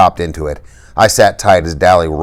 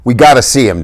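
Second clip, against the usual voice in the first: shaking voice; 7 semitones higher, 5 dB louder than usual. A narrator's reading. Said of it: "His voice was shaking".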